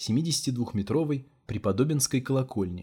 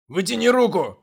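властный пьяный окрик, высоко и громко; рявкни приказ, как пьяный пан; town drunk; 10 semitones higher, 9 dB louder than usual. A narrator's reading. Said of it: "властный пьяный голос". (властный пьяный голос)

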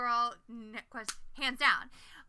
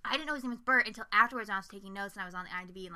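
ordinary delivery

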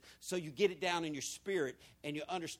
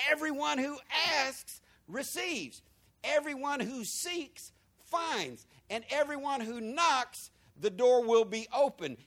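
slow, higher, each phrase set apart; slow emphatic reading; 7 semitones higher, slower than usual. Shown as a demonstration. Pastor reading scripture to congregation, slow and emphatic. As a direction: read it out slow with weight. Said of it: "There's a comma there".

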